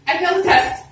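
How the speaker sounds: tearful wail. Retold or imitated. Daughter tells her dad she failed, tearful wail. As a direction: blurt it out sobbing.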